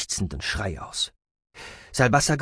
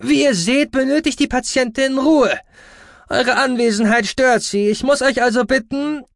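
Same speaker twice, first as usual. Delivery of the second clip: high, loud, officious doctor; calling out; 6 dB louder than usual. Acted. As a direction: order the visitors out. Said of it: "rief der Ara".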